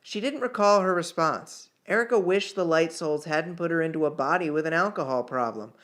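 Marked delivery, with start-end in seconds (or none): none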